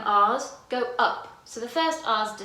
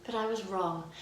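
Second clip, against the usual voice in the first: quiet; 6 semitones lower, 6 dB quieter than usual. Shown as a demonstration. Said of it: "Strong and wrong".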